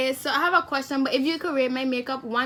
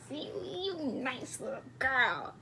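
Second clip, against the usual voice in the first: softer, put-on cooing voice; 10 dB quieter than usual. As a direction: coo it gently like to a child. Cooing at a whispering stranger.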